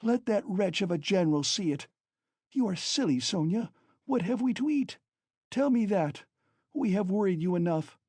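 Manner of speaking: high, feverish cry. Acted. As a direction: call out in frantic despair. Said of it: "she cried".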